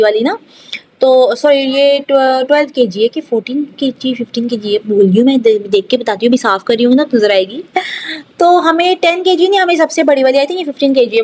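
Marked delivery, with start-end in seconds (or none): none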